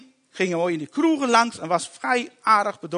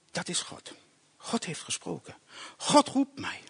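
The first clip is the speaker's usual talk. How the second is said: whispered awe; 2 semitones lower, 8 dB quieter than usual. Real, not acted. Awed realisation of God.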